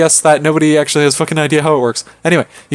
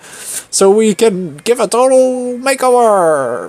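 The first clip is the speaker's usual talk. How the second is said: put-on higher, drawn-out Miranda in a muddled accent; hammed-up fake accent; 6 semitones higher, slower than usual. Miranda plots Liam's makeover, high hammed-up accent. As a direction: overdo a roaming, badly faked accent. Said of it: "switching between, like, seven different accents".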